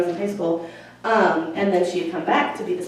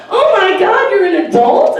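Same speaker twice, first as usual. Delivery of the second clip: much higher and louder amazement; amazed squeal; 11 semitones higher, 10 dB louder than usual. Retold or imitated. Counselor amazed her former student is now an adult. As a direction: gasp it out, amazed.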